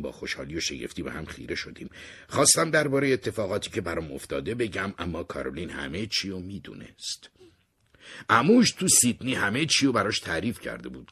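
usual speaking voice

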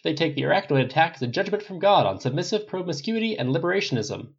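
punchy broadcast voice; TV news report. Acted. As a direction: deliver a live field report from outside the hospital.

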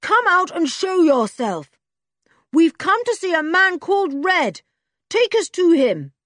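put-on high, loud boy's shout. A narrator's reading. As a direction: yell it out boldly. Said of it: "shouted Julian".

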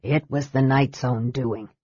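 low, lightly weary voice; weary mage. A narrator's reading. Mage lays blame on the knight, mildly weary. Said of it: "sinking down wearily".

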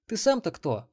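put-on high, suspicious young woman's voice; suspicious girl. A narrator's reading. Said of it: "подозрительно сощурилась Мелисса".

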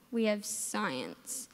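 slow, low, deflated groan; dreading. Pretend. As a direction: sink into disappointment. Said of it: "But at the same time".

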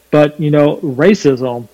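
ordinary talk

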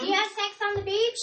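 high-pitched mock innocent question; naive friend ordering. Friend ordering mom a drink at the bar, naive question. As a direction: ask it high, as the clueless friend.

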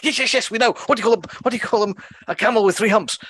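high, hurried, frustrated outburst; mock an exasperated bunny cutting in to hurry it along; impatient bunny. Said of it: "the bunny is jumping up and down".

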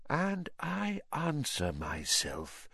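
sinister dark lord's hushed voice; whispered villain. Acted. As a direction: hush it and draw out each word. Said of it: "whispered Voldemort".

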